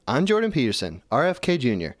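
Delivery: ordinary talk, nothing marked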